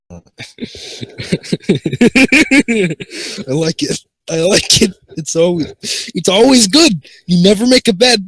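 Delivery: high, loud, laughing praise; tickled and loud, easing down as the laugh settles; cracking up. Real, not acted. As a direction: gush through real laughter. Cracks up over a friend's thumbnail.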